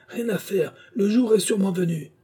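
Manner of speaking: high, fevered raving; raving madman. Acted. Madman continues his threat.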